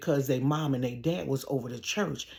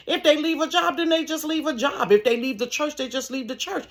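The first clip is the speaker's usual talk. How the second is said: high-pitched, matter-of-fact lecture; sister-in-law lecturing a man over a grudge, raised and firm; retold scolding; 11 semitones higher, 4 dB louder than usual. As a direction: set him straight, no nonsense.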